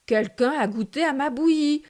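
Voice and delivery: loud, gruff papa bear; Papa Bear's gruff voice. A narrator's reading. Narrator reading Papa Bear's complaint in a loud gruff voice. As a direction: boom it out like a big bear. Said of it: "de sa grosse voix bourrue".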